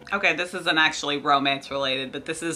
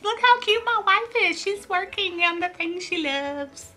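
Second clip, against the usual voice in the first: high, gushing husband voice, slowing at the end; doting husband; 12 semitones higher than usual. Retold or imitated. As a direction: gush like a proud husband. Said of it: "was like".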